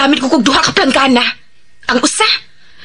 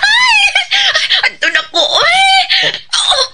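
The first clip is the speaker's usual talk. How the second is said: shrieking laughter; slower than usual. Acted. Widow overjoyed at her late husband's savings, squealing laughter. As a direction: squeal with giddy delight. Giddy shrieking over the fortune.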